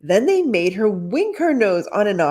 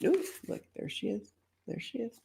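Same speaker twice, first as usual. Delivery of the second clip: excited hush; 4 semitones higher, 12 dB quieter, faster than usual. Real, not acted. Excited aside at picture.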